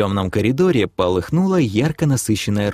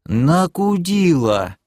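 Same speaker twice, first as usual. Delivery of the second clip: very slow, high, stretched word; parroted retort; 7 semitones higher, much slower than usual. Acted. Elf repeats it to the demoness, slow drawl. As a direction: draw out every syllable innocently.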